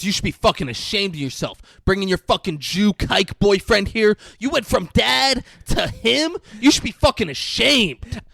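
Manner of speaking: hammed-up furious son; mock angry shout. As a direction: yell at your mother in disgust. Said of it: "He goes".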